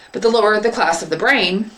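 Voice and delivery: plain reading voice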